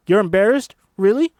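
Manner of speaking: high, loud disbelief; teacher scolding a shy student; incredulous shout. Acted. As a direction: challenge her embarrassment. Teacher challenging Ruby.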